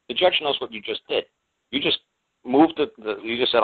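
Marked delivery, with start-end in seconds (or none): none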